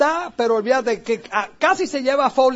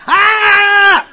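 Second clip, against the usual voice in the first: high loud yell; mock yell; 8 semitones higher, 10 dB louder than usual. Playing the wild drummer, a high loud yell. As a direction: let out a wild rocker's cry.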